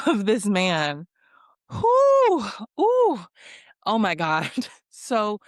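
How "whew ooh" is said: loud, high-pitched whew and ooh; exasperated high whoops. Real, not acted. Viewer reacting to this man's audacity, exasperated whooping. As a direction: let out high whoops of disbelief.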